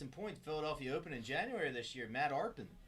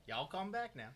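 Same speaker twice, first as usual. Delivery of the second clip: mock Southern drawl; 5 semitones higher than usual. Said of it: "That ain't Texas, though".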